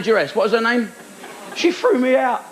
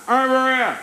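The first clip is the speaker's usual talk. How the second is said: heckler; 7 semitones higher than usual. Retold or imitated.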